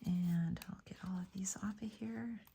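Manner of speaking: whispering. Real, not acted. Whispers peeling dot papers.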